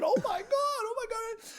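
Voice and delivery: very high-pitched mock awe; starstruck onlookers. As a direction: squeal like a dazzled crowd. Voicing awestruck restaurant onlookers.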